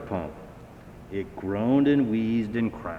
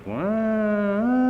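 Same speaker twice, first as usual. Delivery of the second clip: very high siren cry; siren wail; 11 semitones higher, 3 dB louder than usual. A narrator's reading. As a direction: sing out a wailing siren. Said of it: "The sirens faded away".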